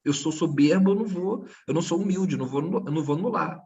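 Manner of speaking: mock the arrogant board official; high, mocking voice of a stubborn board official; mock stubborn examiner. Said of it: "o cara não".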